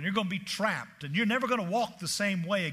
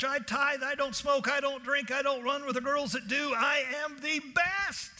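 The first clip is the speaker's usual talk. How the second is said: high, bragging self-praise; boastful Peter; 7 semitones higher than usual. Retold or imitated. Preacher imitating Peter boasting to Jesus, high and cocky. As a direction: puff yourself up, higher and insistent. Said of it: "Peter jumps up and says".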